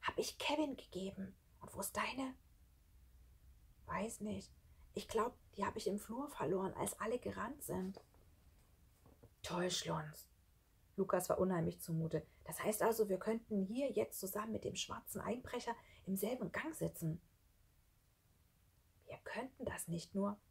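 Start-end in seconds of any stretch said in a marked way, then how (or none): 0.0-10.3 hushed exchange between hiding friends; soft, whispery dialogue; whispering. Acted. 12.4-17.2 soft, anxious half-whisper; hushed unease. Acted. Boy realises danger, hiding. 19.0-20.4 soft, secretive whisper; hushed, breath-only voice. Acted. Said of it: "flüsterte Schlunz".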